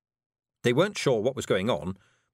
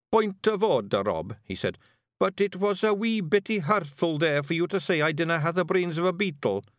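high Scots, mildly wounded; a polite complaint; hurt Feegle; 8 semitones higher than usual. A narrator's reading. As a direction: Daft Woolly, lightly hurt. Daft Woolly complains.

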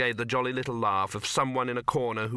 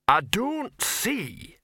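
high, slow troll objection; huffy, drawn-out troll; offended troll; 10 semitones higher, much slower than usual. Acted. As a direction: break in ponderously with a grievance.

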